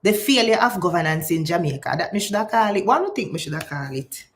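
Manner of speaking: usual speaking voice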